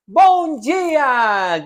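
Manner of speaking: high, loud, drawn-out "Bom dia"; cheery morning greeting. Acted. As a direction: sing out a morning greeting with stretched vowels. Acting out a morning greeting heard.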